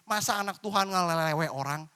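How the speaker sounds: usual speaking voice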